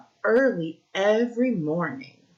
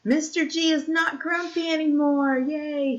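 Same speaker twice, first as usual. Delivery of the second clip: excited cheer; 9 semitones higher than usual. Acted. Happy ending cheer to children.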